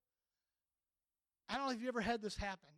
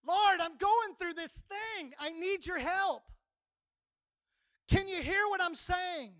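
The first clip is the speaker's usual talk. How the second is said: high, loud, urgent prayer; loud pleading cry; 7 semitones higher, 5 dB louder than usual. Acted. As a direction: cry out to God, piling plea on plea. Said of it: "Or it could be".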